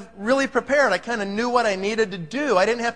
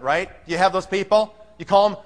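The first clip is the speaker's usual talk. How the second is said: ordinary delivery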